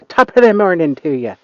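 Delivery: put-on second, bolder try at an Irish accent. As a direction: go for it, a hammed-up Irish brogue. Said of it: "if that's a good Irish accent".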